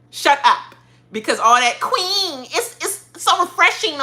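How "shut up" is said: higher, louder playful shut up. Real, not acted. Telling Andy Herron again to shut up, louder. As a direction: push it harder the second time.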